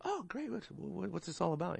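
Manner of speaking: high, put-on surprised brightness; mock surprise. To a Jehovah's Witness at the door, mock surprised. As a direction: feign delighted surprise at the door. Retold young self greeting witness.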